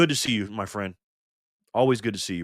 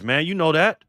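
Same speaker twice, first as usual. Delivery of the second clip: ordinary delivery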